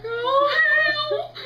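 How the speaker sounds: high, loud wail of dread; hammed-up, drawn-out horror; horrified cry. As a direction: cry out at the sight of a needle. Reacting to seeing the needle.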